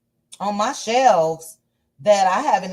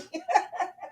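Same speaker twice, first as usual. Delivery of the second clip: short high-pitched laugh; quick amused giggle; high delighted laugh; 11 semitones higher, 8 dB quieter than usual. Real, not acted. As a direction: let a soft squeal of laughter out. Reacting to a funny chat comment.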